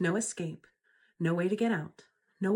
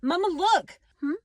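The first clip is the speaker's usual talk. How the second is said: put-on excited little kid; 7 semitones higher than usual. Mom acts her little kid.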